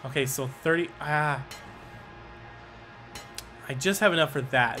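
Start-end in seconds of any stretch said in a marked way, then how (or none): none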